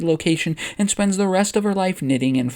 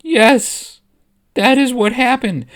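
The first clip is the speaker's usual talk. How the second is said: freaking out; 8 semitones higher, 5 dB louder, slower than usual. Acted. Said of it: "internally she is freaking out here".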